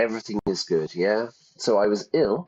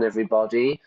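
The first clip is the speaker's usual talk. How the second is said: ordinary delivery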